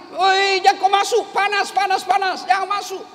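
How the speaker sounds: high, urgent calls of "panas"; alarmed warning shout. Retold or imitated. Villager shouting at a stranger not to enter the hot lift. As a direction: warn him off before he burns.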